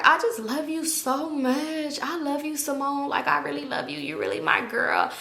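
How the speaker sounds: put-on slow, soft sappy drunk voice; mock drunk affection. Clingy drunkenly gushing at Simone by mirror, soft slow sappy. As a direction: drag it out like a sloppy drunk friend.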